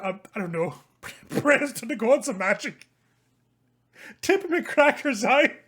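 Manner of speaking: loud, high, hearty tavern swagger; boisterous sellsword. Acted. GM voicing Liam to the tavern crowd, loud and jovial. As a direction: play the grinning rogue working the room.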